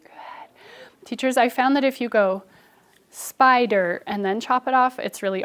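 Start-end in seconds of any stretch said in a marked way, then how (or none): 0.0-1.1 whispered praise. Real, not acted. Quiet praise to child.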